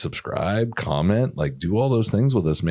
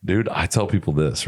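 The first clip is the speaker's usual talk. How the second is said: usual speaking voice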